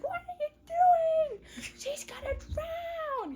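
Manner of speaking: squealed mock alarm; frantic mock yell. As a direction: shriek like a panicking mother, hammed up. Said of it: "Someone came and yelled at him".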